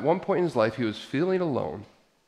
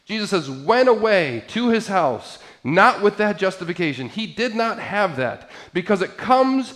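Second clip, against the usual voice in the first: raised, driving pulpit voice, higher and louder; preaching at full force; 6 semitones higher, 7 dB louder than usual. Acted. Pastor to congregation, telling Jesus' parable, preaching loudly. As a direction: preach it out to the whole church.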